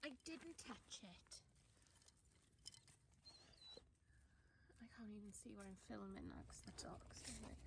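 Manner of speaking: hushed unvoiced whisper; whispered aside while filming the dog's paw. Real, not acted. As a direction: whisper so as not to disturb the dog. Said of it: "whispering near dog".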